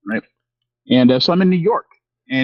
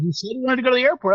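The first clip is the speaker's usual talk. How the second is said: driver explaining; 11 semitones higher, much faster than usual. Retold or imitated. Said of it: "He goes".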